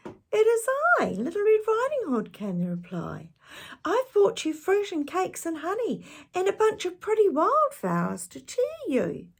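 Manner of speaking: put-on very high, sweet child; little girl voice. Girl at grandmother's door, high and sweet. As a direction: sing it out sweetly.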